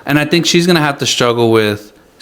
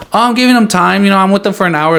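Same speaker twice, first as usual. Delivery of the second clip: higher, smug mock of a phone-distracted parent; 7 semitones higher, 3 dB louder, faster than usual. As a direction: mimic a self-satisfied parent, pitched up.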